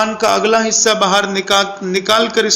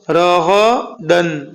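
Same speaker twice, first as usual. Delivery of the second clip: راغدن drawn out, syllable by syllable; slow reading; 2 semitones lower, much slower than usual. Shown as a demonstration. Quran teacher to pupils, slow drill. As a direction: read راغدن slowly, each syllable apart. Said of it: "لیکن اس کو جلدی پڑھیے".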